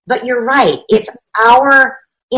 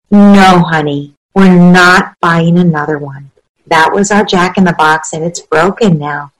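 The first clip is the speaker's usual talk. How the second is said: firm kind limit; 4 semitones lower, 8 dB louder than usual. Acted. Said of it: "That parent says".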